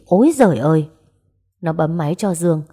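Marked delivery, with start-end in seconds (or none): 0.1-0.9 louder, dismayed outcry; alarmed exclamation. A narrator's reading. Narrator imagines a knife to the face, alarmed outcry. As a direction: cry out in mock horror.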